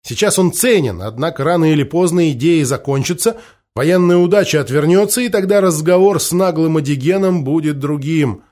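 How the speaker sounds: ordinary delivery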